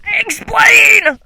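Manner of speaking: high, loud demand; demanding shout. Real, not acted. Streamer yelling at the cartoon character to explain. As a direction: shout it at the screen.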